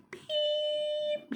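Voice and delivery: high, thin sustained beep; imitated beep. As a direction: mimic a long flatline tone. Voices a beep for a death.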